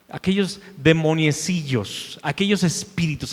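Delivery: ordinary talk, nothing marked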